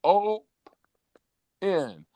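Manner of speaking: put-on a proud little kid reading "open" aloud; child sounding out a word. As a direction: daughter sounds out the store sign, high triumphant child voice. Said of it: "She goes".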